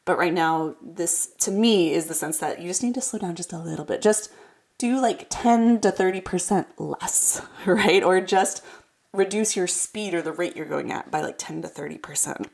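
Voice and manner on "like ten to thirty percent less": normal talking voice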